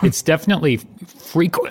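coughing mid-sentence. Pretend. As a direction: cough breaks the name. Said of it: "Choking Every Other Word".

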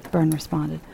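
ordinary delivery, nothing marked

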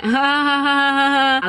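high, drawn-out put-on laugh; mock laugh. Reacting to the guy's crude offer, mock laughter. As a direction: fake a high ha-ha at him. Acting her laugh at proposition.

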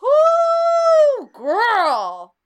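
very high, loud whoop. Real, not acted. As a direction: whoop it like big news. Asked about the Menendez brothers.

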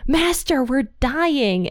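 lightly dramatized high plea; mock panic. Disciples waking Jesus in the storm, mock panic. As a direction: act out a mild, high-pitched alarm.

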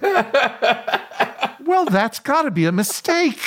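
high-pitched, incredulous protest; disbelieving denial. Retold or imitated. Caught passenger denying the news on his phone, high disbelief. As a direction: mimic someone brightly refusing bad news.

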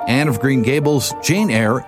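usual speaking voice

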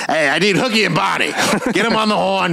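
put-on brash old-school record producer; cigar-chomping producer. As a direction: bark it like a big-shot producer with a cigar. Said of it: "a cigar chopping, like, producer".

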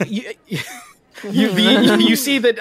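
ordinary delivery

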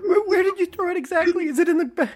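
high, wailing mock sob; hammed-up tearful pleading; mock crying boy. Boy pleads with mom about the trash, high mock sob.

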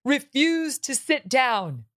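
fake-weeping over a trivial memo; mock tearful reading.

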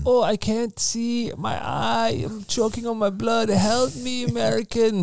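high, strained dying plea; mock dying soldier. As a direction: whimper it like a wounded man begging. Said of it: "Something like that".

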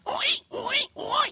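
high squealing pig oi. Acted. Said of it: "And a pig was passing by".

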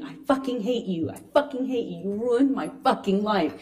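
usual speaking voice

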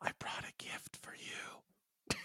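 slow, hushed whisper; Anthony Mint. Retold or imitated. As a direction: imitate a notorious whisperer, drawn out and barely audible. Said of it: "a notorious whisperer".

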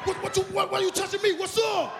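high-pitched defensive outburst; mock outrage, high and stammering; indignant protest. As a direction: act falsely accused, voice jumping way up. Acts out being caught stealing.

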